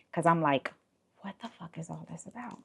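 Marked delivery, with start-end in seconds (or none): 1.2-2.6 quiet under breath. Retold or imitated. Inner thought while keeping calm.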